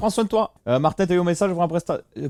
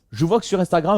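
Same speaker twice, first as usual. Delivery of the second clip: usual speaking voice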